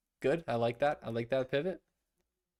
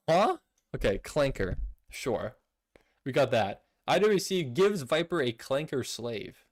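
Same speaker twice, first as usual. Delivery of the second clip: ordinary talk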